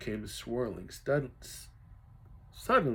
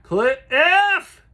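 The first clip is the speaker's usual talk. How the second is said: high, loud drawn-out call; boy calling to his lost brother; long call; 14 dB louder than usual. Acted. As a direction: call after him as he vanishes. Calling after swept son.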